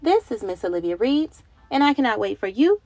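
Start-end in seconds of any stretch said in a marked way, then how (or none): none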